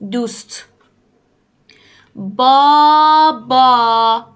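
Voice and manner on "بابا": بابا drawn out slowly and loud, each syllable clear; carefully spelled-out بابا; slow teaching voice. Shown as a demonstration. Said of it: "نگاه کن".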